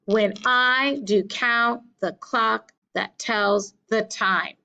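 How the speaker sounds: slow, measured "when I do COUNT the CLOCK", every second syllable stressed; iambic reading. Shown as a demonstration. Teacher reading a sonnet line for the class, iambic beat. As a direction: stress I, count, clock, tells, time, slowly. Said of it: "iamb gets the beat".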